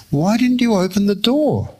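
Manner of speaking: high, insistent retold challenge; incredulous question. Friend insists on an answer over breakfast, mock reproachful.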